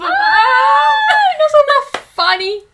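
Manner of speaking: a delighted squeal that breaks into a cry. Real, not acted. Friend squealing with delight over the cute characters, very high and loud.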